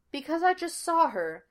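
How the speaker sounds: stunned, pitch lifted; dazed. A narrator's reading. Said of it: "Sean said dazedly".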